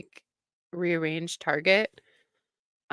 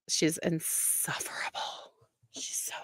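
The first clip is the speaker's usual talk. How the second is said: breathy catty whisper, much softer; 3 semitones lower, 10 dB quieter than usual. Real, not acted. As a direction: whisper it like gossip you shouldn't share. Catty aside about Jane.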